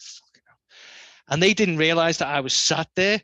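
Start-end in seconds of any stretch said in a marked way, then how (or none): 0.0-0.6 breathy hushed under-the-breath curse; whispered swear. Retold or imitated. Overheard plotting, whispering to himself in shock. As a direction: say it so nobody hears you.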